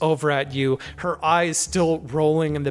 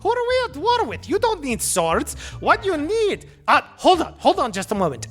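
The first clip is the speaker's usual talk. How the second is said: much higher, sing-song goblin voice; excitable goblin; 11 semitones higher than usual. Acted. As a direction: pester like a desperate huckster.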